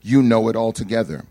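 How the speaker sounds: loud, slow, solemn scripture reading. Acted. Preacher reads Psalm 139 aloud to the congregation, solemn and loud. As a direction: proclaim the psalm slowly to the congregation.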